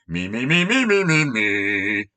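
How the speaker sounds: rising, sing-song self-obsessed chant; childish mock of self-centredness. Mocking Jesus' self-focus, sing-song chant. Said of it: "emphasis on the me".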